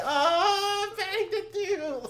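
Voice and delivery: very high, loud mock wail; hammed-up tearful wail through laughter; losing it. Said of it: "and I lost it".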